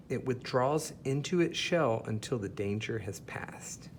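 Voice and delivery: ordinary delivery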